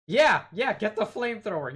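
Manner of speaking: loud, high, eager yelling; fired up to burn the doll; excited. Real, not acted. Joking reaction to creepy doll.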